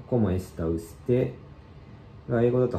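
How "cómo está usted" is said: slow, careful ¿Cómo está usted？ with a rise on usted; slow model reading. Shown as a demonstration. Teacher slowly models the second line for learners. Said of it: "二行目の、".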